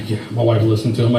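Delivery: ordinary talk, nothing marked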